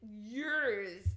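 normal talking voice

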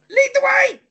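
high, loud rallying cry; eager call. Acted. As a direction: call out to lead the adventure. Setting off on the adventure.

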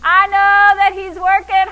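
loud, high crow into laughter; laughing. Shown as a demonstration. Believer sure that God is working, exultant laughing. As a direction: shout it gleefully, then crack up. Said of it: "A believer starts laughing".

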